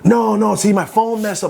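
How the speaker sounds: high, flustered excuse; imitating George. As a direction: stammer an excuse in a squeaky voice. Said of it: "I'm like".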